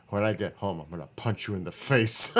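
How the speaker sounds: mock furious threat, "face" shouted out; imitating angry mom. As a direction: play the fuming mother. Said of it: "That's how mad she was".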